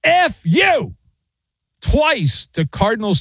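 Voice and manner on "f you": mock referee yell, pitched high; retold yell. Official swearing at the tight end, high mock yell. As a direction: toss off the ref's curse in a high yelp.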